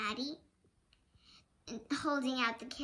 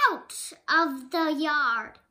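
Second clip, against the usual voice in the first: plain reading voice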